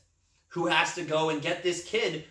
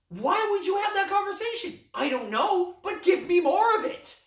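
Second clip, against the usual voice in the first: much higher, strained exclamation; mock-incredulous excitement; 4 dB louder than usual. Film fan marvelling at an absurd conversation, high-pitched excitement. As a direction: squeal it in delighted disbelief.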